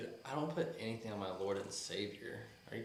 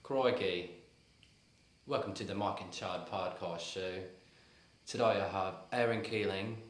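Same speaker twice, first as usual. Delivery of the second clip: amateur Australian accent; broad, unconvincing Australian accent; 4 dB louder than usual. Shown as a demonstration. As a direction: show host with a put-on Australian accent. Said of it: "I like to talk in an Australian accent".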